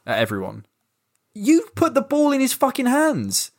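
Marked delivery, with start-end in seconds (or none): 1.3-3.5 much higher, exasperated cry; exasperated outburst. Real, not acted. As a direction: throw up your hands at the bad corner. Venting at Ceballos's corner.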